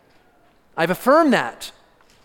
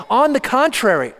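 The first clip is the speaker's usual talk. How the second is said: "on the contrary" lifted higher with emphasis; emphatic contrast; 5 semitones higher than usual. Shown as a demonstration. Preacher explaining what the Greek word really means, emphatic contrast. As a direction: stress "on the contrary" firmly, pitched up. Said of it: "really an emphatic contrast".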